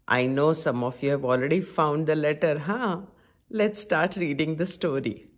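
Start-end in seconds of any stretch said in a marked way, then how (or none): none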